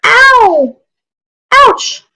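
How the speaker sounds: very high squeaky yelps; mouse in pain. Acted. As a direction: squeak out little cries of pain. Mouse getting stepped on.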